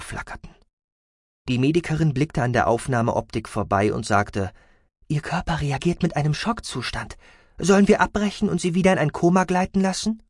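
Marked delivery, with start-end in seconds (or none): 5.1-10.2 higher-pitched medic voice; higher, questioning female medic; concerned medic. A narrator's reading. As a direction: voice a medic urgently asking for a decision.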